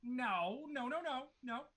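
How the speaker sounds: high, hurried refusal; Moorcroft's voice. Acted. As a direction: wave them off in a high voice.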